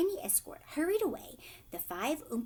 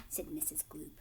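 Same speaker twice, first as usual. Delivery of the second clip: plain reading voice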